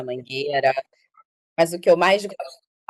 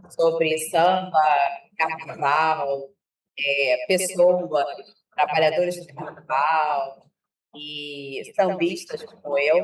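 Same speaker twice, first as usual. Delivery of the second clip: slow, spaced-out phrases; slow and deliberate; slower than usual. Real, not acted. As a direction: speak slowly word by word over the echo. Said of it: "então vou falar bem devagar".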